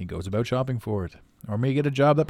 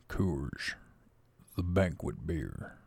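lower, slow gravel-toned beer ad voice; Sam Elliott impression; 6 semitones lower, 8 dB quieter, much slower than usual. Retrying the beer ad for viewers, lower and slower. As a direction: go deeper and drag it out like an old cowboy. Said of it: "Wait, let me try that again".

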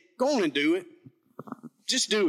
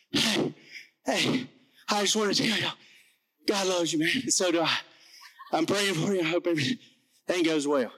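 panting out the words after a sprint; breathless retelling. Retold or imitated. Out-of-breath man rushing up to tell a family God loves them. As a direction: gasp it out like you just ran up to them. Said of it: "It wasn't quite that dramatic".

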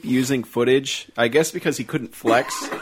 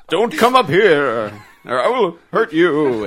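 mock cowering; 3 semitones higher, 6 dB louder, slower than usual.

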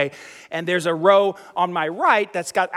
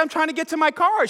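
high-pitched, exasperated reply; defensive protest; 8 semitones higher than usual. Retold or imitated. Passenger answering an angry mother, defensive. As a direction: plead your innocence in a raised pitch.

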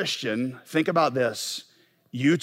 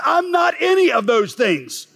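much higher, slow, shouted declaration; God's firm reply; 13 semitones higher, slower than usual. Acted. Preacher voicing God rebuking the believer's self-doubt, shouted slow. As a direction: answer every doubt with a ringing no. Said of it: "God says".

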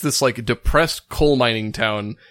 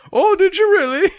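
much higher, louder mock amazement; mock surprise; 5 dB louder than usual. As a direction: gasp it out in exaggerated wonder.

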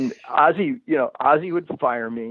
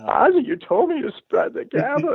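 high pleading protest. Retold or imitated. Pleading excuse to the boss.

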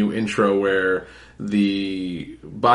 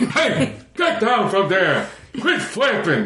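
high, laughing guard telling them off; annoyed guard; 10 semitones higher than usual. Acted. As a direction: shoo the birds away in a squeaky voice. Voicing a guard.

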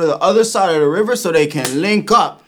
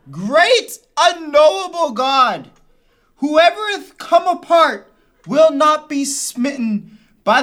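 put-on high, slow old-English preacher voice; pompous King James prayer; mock biblical prophet; 8 semitones higher, 5 dB louder, slower than usual. Skit: overwrought archaic prayer.

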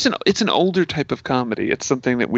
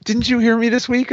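hopeful; 11 semitones higher, 5 dB louder than usual. Retold or imitated. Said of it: "I was like".

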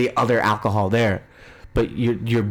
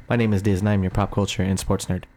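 ordinary talk, nothing marked

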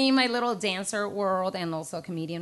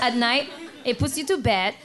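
usual speaking voice

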